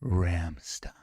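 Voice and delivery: low, soft, drawn-out murmur; mock ASMR. Shown as a demonstration. Cohost teasing about being turned on, low ASMR voice. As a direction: linger on the name, low and soft. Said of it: "let me try doing A S M R".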